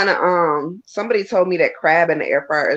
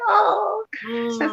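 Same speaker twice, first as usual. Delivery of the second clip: excited gush; 14 semitones higher, 4 dB quieter than usual. Retold or imitated. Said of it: "I be like".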